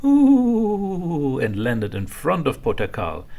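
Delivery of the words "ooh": high, loud ghost moan; ghostly ooh. A narrator's reading. Little ghost flies in, loud high eerie ooh. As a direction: swoop in with a spooky ooh. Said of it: "a little ghost came flying".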